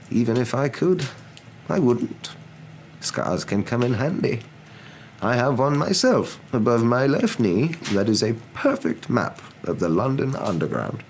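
high, slow wise-elder voice; Dumbledore. A narrator's reading. Dumbledore answering McGonagall.

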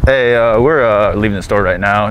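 usual speaking voice

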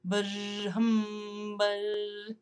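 steady droning buzz and hum; mock insect buzz; buzzing drone. A narrator's reading. Narrator voices insect sounds.

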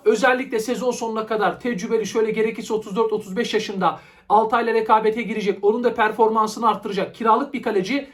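normal talking voice

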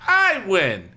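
loud, high boast; triumphant declaration. A narrator's reading. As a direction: crow it like a bratty kid brother. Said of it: "he declared".

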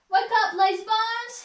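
high, loud wake-up call; cheeky whistling engine. A narrator's reading. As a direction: startle a dozing engine awake. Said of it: "he would whistle".

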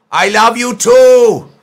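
loud, high, delighted; beaming shout back to fans; excited shout. Real, not acted. Fan message says we love you.

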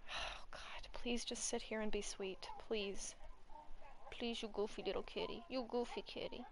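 whispered, begging the cat to settle; soft whispered plea to a kitty; pleading whisper. Real, not acted. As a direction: coax softly so the stream can't hear. Soothing cat off-mic.

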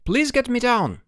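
high-pitched, plaintive plea; pleading stranded boy. A narrator's reading. Stranded boy begging Boots for rescue, pleading.

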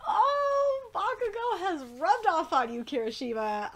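long high "Oh", then loud; excited exclamation. Real, not acted. Reader reacting to Kirishima talking like Bakugo, delighted outburst. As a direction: crow it loudly, high at the start. Exclaiming at a character's crude speech.

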